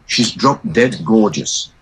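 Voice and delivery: ordinary talk, nothing marked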